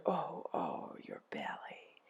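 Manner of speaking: low, whispery slow groan; soft echoed groan. A narrator's reading. Helpers echo Ereshkigal's pain, low hushed groan. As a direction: groan it back under the breath. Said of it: "They groaned".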